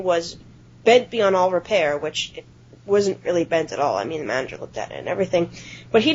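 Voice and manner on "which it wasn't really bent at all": usual speaking voice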